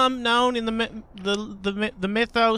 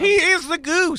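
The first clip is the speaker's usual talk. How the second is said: emphatic proclamation; 7 semitones higher than usual. Pretend. Player proclaiming his friend has become the goose, emphatic proclamation. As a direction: proclaim it to the whole table.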